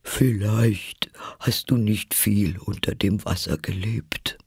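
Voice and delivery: breathy, tearful Mock Turtle; mournful, airy creature voice; tearful storytelling. A narrator's reading. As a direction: sigh it out through lingering tears. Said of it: "während Tränen ihre Wangen herabflossen".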